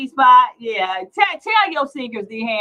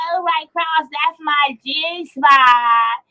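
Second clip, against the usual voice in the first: mock woman's voice; 4 semitones higher, slower than usual. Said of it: "And she went".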